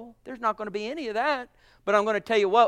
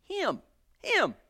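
ordinary talk, nothing marked

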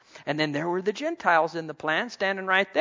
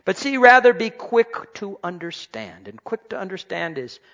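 usual speaking voice

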